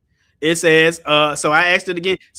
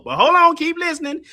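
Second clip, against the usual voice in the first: usual speaking voice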